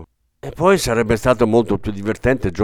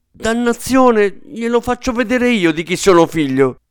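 put-on a furious old general bursting out; fierce outburst; 11 semitones higher, 4 dB louder than usual. A narrator's reading. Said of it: "con voce ferina". (sfogo feroce e rabbioso)

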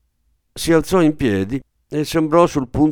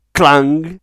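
loud, higher ringing clang; clanging sound word; 4 semitones higher, 7 dB louder than usual. A narrator's reading. Narrator imitating a dropped basin, loud clang. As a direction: call out the crash.

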